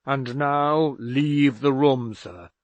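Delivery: loud, slow command; father dismissing his son in anger; angry father. A narrator's reading. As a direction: order him out of the room.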